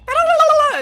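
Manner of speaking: high, loud rushed "la-la-la" babble; rapid gibberish babble. Retold or imitated. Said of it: "it's so fast".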